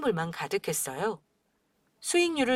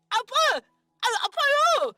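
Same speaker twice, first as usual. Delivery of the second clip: much higher yelping cries of pain; pain yelps; 12 semitones higher, much slower than usual. Acted. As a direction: yelp as if being struck. Son is beaten by father.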